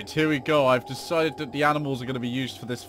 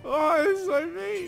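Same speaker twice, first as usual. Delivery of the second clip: high anguished whine; 4 dB louder, slower than usual. Real, not acted. Cornfields destroyed by attack.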